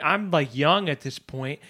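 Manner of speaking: ordinary talk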